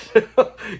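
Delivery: short, loud real chuckle; laugh. Real, not acted. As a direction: chuckle at the quoted line. Laughs after quoting Fred.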